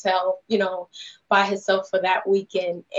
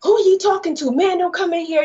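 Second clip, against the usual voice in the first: retold shout; 9 semitones higher, 4 dB louder than usual. Moviegoers shouting back at him.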